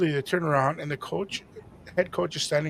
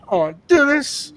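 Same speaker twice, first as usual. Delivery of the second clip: higher, louder nervous protest; a nervous, higher cry of reluctance; anxious whine; 10 semitones higher, 8 dB louder than usual. Retold or imitated. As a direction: squirm under everyone's eyes. Awkward, 300 people staring.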